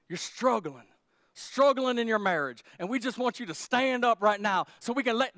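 hammed-up charismatic pastor getting a word; mock prophetic preacher. Preacher parodying a pastor calling out a couple, mock dramatic. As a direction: play the over-the-top prophet, lightly. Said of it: "I didn't say".

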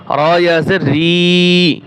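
ordinary talk, nothing marked

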